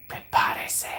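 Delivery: voiceless, drawn-out whispered Spanish; dramatic whisper. Retold or imitated. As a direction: whisper it like a secret warning. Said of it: "He whispered".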